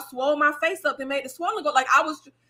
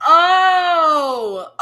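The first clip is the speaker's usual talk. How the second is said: a loud, high "Oh" of sudden realization; surprised shout; 3 semitones higher, 6 dB louder than usual. Real, not acted. Reading the clarification, a loud surprised shout. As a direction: cry out as it finally clicks.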